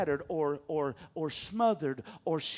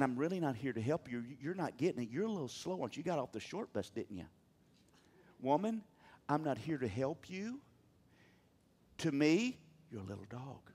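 hushed, lower, teasing taunt; soft taunting; 5 semitones lower, 9 dB quieter, faster than usual. Acted. Jesus softly taunting the woman, lower and hushed. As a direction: murmur the put-down softly, almost amused. Preacher voices Jesus, mocking.

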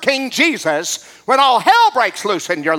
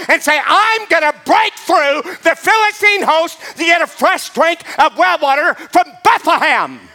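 full-force preaching; 7 semitones higher than usual. Acted. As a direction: preach it up till you're shouting. Rising into full-force preaching climax.

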